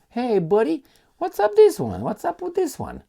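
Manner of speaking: high, loud teasing hey buddy; mocking call-out. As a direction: taunt the headline in a high sing-song. Calling out headline mockingly.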